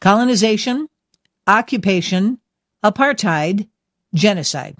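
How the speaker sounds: each word set apart by pauses; said slowly. Shown as a demonstration. Host presenting four key words to listeners, slowly and separately. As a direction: say them slowly, one by one, like a list. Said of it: "I'm gonna say them slowly".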